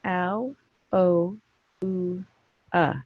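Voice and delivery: "Ow", "oh", "ooh", "uh" as separate vowels; phonics sounds. Shown as a demonstration. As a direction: sound out "Ow, oh, ooh, uh" evenly, pausing between. Teacher sounding out spelling's sounds.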